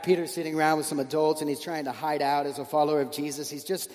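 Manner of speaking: ordinary talk, nothing marked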